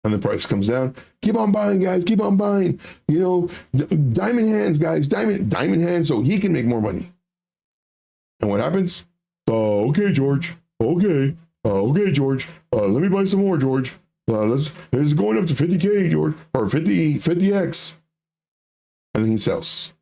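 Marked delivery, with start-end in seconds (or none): 1.2-7.1 crypto bro. Retold or imitated. Voicing pump group owner. 9.5-18.0 gullible follower voice. Retold or imitated. Voicing gullible buyer.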